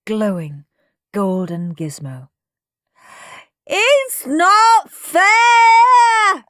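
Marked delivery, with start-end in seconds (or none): 3.6-6.4 very drawn-out, loud, high howl. A narrator's reading. Henry seeing his brother's gold gizmo, howling. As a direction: howl each word in a tantrum. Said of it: "howled Henry".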